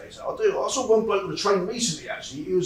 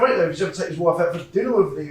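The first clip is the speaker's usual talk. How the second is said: normal talking voice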